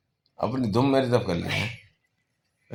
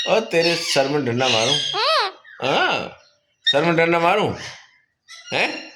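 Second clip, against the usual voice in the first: mock threat; 6 semitones higher than usual. Real, not acted. Threatening the parrot playfully.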